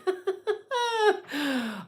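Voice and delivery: high squealing burst of laughter; real amused squeal. Real, not acted. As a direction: let out a giddy high laugh. Laughs at own answer.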